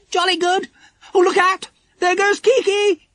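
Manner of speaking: very high, thrilled exclamations; excited shout. A narrator's reading. Dinah spotting Kiki above, shouting excitedly. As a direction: cry out in high-pitched delight, stretching the end. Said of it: "shouted Dinah in excitement".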